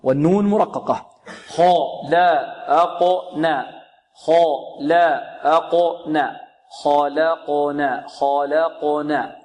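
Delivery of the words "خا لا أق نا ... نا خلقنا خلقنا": very slow drill of خلقنا: heavy خ, light ل, bounced dark ق, light ن; تقطيع المقاطع. Shown as a demonstration. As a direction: sound out خلقنا syllable by syllable, then joined. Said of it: "والقاف مقلقلة ومفخمة". (تقطيع خلقنا ببطء مع التفخيم والترقيق)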